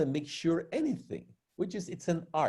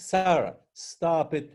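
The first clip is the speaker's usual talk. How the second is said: ordinary talk, nothing marked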